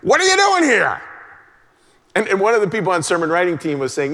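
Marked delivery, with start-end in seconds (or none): 0.0-1.0 high, loud accusing parent snap; mock angry parent. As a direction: shout it like an annoyed parent catching a kid. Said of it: "I think a lot of the time it's like".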